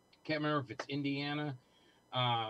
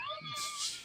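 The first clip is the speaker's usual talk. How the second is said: laugh; 6 dB quieter than usual. Real, not acted. Laughing after hanging up.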